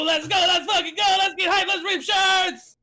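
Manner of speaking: very high, loud hype yell; hype shout. Shown as a demonstration. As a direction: blow the roof off to rile the crowd up. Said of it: "and then you go".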